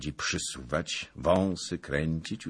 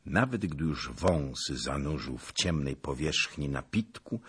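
usual speaking voice

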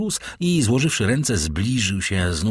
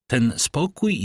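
ordinary delivery, nothing marked

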